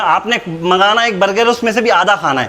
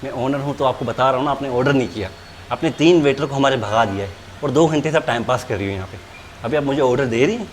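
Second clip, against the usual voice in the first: suddenly soft and lower, overly courteous; mock polite; 5 semitones lower, 6 dB quieter than usual. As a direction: play the humble gentleman, hands folded. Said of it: "अभी तो प्यार से बात कर रहा हूँ". (नकली अदब से, धीमी नरम आवाज़ में)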